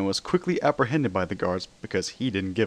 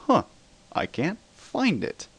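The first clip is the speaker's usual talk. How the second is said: higher, slow, puzzled Spider-Man; confused; 9 semitones higher, slower than usual. A narrator's reading. Spider-Man answers the judge, fumbling and confused. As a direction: search your pockets, lost and baffled. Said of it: "voice full of confusion".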